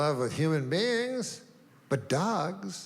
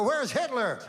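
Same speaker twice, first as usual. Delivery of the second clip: high, eager puppy voice; eager dog voice; 6 semitones higher than usual. Retold or imitated. Hitler's dog searches for him, high and eager. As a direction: ask like a dog looking for its master.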